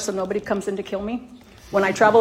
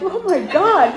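shocked realization; 9 semitones higher, 7 dB louder, slower than usual. Retold or imitated. Recognizing her sons.

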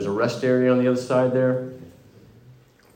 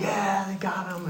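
relieved; 8 semitones higher, 7 dB quieter than usual. Retold or imitated. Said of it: "And he's happy".

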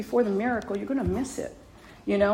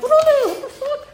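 much higher, startled yelp with a laugh; shocked shriek; 6 dB louder than usual. Retold or imitated. Mother startled as the Grinch grabs her suitcase, shrieking. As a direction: squeal in alarm, half laughing.

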